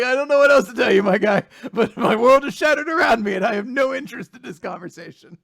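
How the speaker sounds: loud, high, hammed-up rant; mock exasperated outburst. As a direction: declare it loudly, easing off toward the end. Exaggerated retold reply over laughter.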